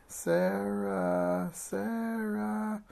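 drawn-out wailed call; acted child's wail for help. A narrator's reading. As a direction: wail the name, leaping up high on the repeat. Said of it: "wailed Lottie".

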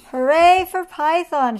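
high, loud group cheer; shouted. A narrator's reading. Everyone honours Python, shouted cheer. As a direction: cheer the hero loudly. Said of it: "shouted everyone".

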